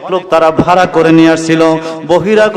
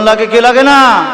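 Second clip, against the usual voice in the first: raised pitch, rousing call to the crowd; louder prompt; 6 semitones higher, 3 dB louder than usual. Shown as a demonstration. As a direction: push "লাগে কি লাগে না" higher to rouse listeners. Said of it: "আর একটু জোরে বলেন".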